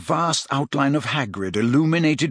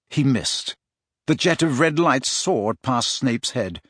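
ordinary delivery

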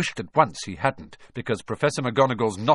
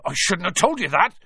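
higher, louder, sudden alarm; horrified blurt; 8 semitones higher, 6 dB louder than usual. A narrator's reading. Hagrid realises he let the secret slip, horrified blurt. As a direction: blurt it as the mistake dawns on you. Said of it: "he blurted out".

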